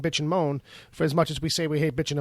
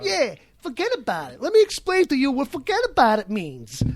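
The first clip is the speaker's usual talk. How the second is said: a New York mobster explains forget about it, in a hammed-up accent; mock mobster accent; 8 semitones higher than usual. Scene: a mobster explains forget about it, hammed-up New York accent. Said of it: "he's like".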